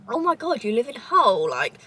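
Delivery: ordinary delivery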